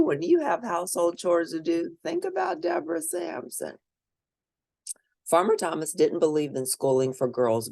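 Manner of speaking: plain reading voice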